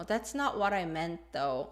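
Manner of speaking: mild objection with a falling end; hesitant, non-confrontational. Shown as a demonstration. Teacher voicing someone who won't fight, hesitant. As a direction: disagree gently, letting it drop. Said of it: "it shows hesitation and it's non-confrontational".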